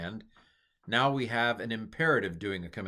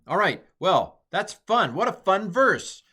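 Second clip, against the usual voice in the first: delighted; 10 semitones higher, 9 dB louder than usual. Real, not acted. Delighted closing exclamation.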